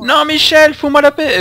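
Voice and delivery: high shouted plea; panicked yell. Real, not acted. Shot at, yells at attacker. (crie paniqué et aigu)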